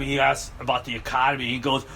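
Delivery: usual speaking voice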